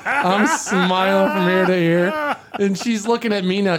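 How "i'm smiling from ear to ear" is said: slow, through laughter; laughing. Real, not acted. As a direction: grin through the words, laughing. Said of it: "I'm dying laughing now".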